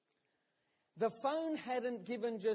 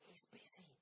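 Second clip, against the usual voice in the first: soft, voiceless reply; whispered; 27 dB quieter than usual. Retold or imitated. Child whispering to the caller about the policeman. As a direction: say it in a hushed breath. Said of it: "Back came the whispered reply".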